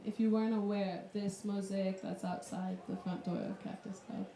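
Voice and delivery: ordinary talk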